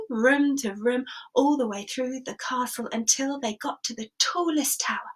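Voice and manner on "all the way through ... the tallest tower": usual speaking voice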